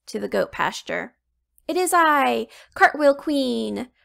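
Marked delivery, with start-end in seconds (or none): 1.7-3.9 bold hero announcement. Acted. Hero announces herself boldly.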